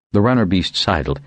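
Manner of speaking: ordinary delivery, nothing marked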